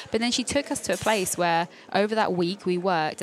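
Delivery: ordinary delivery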